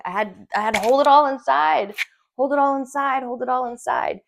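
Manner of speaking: loud, high, insistent repetition; reliving bottled-up distress; strained, pent-up. Retold or imitated. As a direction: push each phrase out under pressure. Reliving forced holding back of tears.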